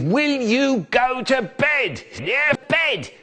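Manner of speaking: high exasperated yell; raving brother. Retold or imitated. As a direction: rave at him to go to bed. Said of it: "he'd thrash and rave".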